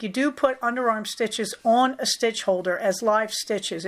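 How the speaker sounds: usual speaking voice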